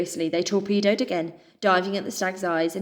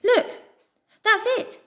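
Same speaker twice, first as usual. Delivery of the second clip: high, loud triumphant cry; jumping-up-and-down triumph; joyful cry; 4 dB louder, slower than usual. A narrator's reading. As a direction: shout it in triumph. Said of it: "Pip cried".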